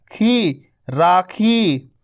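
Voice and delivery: राखी read very slowly, रा drawn long; slow syllable demo. Shown as a demonstration. Teacher reading rakhi very slowly for children. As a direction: spell it out slowly. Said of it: "तो रा को खींचो".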